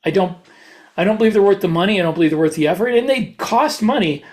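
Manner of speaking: normal talking voice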